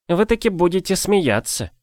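badly faked Odessa accent for a joke; clumsy Odessa accent. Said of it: "неумело имитируя одесский акцент".

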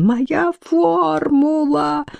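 thin, high wail, slowly drawn out; shrieked squeal. A narrator's reading. Scientist laments his formula before running, squealing. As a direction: squeal it high and long, without volume. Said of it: "взвизгнул он".

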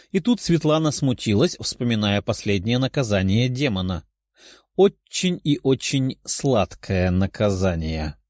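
plain reading voice

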